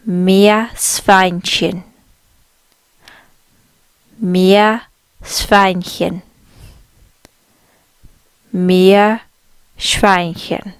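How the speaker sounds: Meerschweinchen drawn out very slowly, syllable by syllable; slow careful Meerschweinchen. Shown as a demonstration. Teacher breaking down a long word for learners, very slow. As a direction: spell out Meerschweinchen slowly for beginners. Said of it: "the word for guinea pig in German is".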